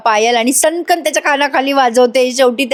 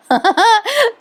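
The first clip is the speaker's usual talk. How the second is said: high burst of giggles; short squealing laugh; real laugh; 11 semitones higher than usual. Real, not acted. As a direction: break into amused high laughter. Laughs at mumbling character.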